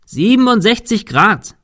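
high shout into the wind at normal pace; calling out. A narrator's reading. Man giving his friend the dish angle, calling against the wind. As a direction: shout the figure across a roof. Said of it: "rief Thomas dem Wind entgegen".

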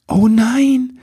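high-pitched "oh no" wail; dismayed cry. A narrator's reading. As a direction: cry out as the blunder sinks in. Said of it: "rief Ribbelwitsch". (entsetzter, hoher Ausruf)

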